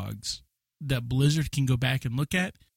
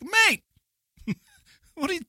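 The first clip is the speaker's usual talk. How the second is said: high-pitched hammed-up Australian; mock Australian accent; 13 semitones higher than usual. Watching Australian friend to bungling player, mock Australian protest. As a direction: scold a mate in a silly high Australian voice. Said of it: "Actually, he's Australian. He'd be like".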